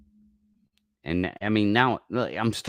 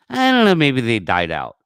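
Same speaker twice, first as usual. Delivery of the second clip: mock skeptic; 7 semitones higher, 8 dB louder than usual. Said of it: "who the fuck in the Bigfoot world ever says".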